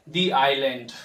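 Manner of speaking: "the" in "the island" as long "thee"; correct pronunciation. Shown as a demonstration. Teacher modelling the rule, "the island" with "thee". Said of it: "इसको हमें पढ़ना होगा".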